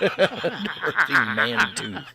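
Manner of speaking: slow, high, laughing drawl. Pretend. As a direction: savor the name through a laugh. Savoring the quoted name.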